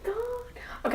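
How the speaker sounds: whispered high exclamation; whispering. Real, not acted. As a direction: breathe it out as a whisper. Whispered reaction.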